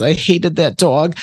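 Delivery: high, slow imitation of a thick Jersey accent. Medium voicing a laughing spirit's thick Jersey accent. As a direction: ham up a brassy New Jersey woman cursing the dog. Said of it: "thick Jersey accent".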